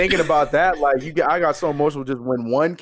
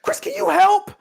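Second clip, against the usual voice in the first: much higher mock pleading voice; imitating a plea; slower than usual. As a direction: whine for help like her. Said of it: "mother-in-law asking".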